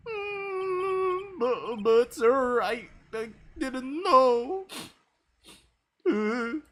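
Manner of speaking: put-on a rattled young officer caught out by his boss; flustered goofy cop. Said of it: "Let me try and find a voice for him".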